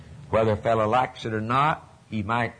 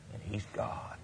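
whispered, almost silent; hushed whisper; 4 semitones lower, 16 dB quieter than usual. Acted. Preacher whispering the climax to the church, hushed. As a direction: let it fall to a whisper.

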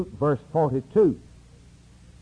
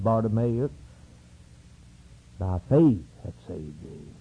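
gentle blessing; 8 semitones lower than usual. Retold or imitated. Christ blessing the blind man.

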